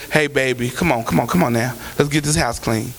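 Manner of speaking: gently coaxing someone to clean the house; gentle. Shown as a demonstration. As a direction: coax them kindly, like a loving parent. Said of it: "See, gentle is".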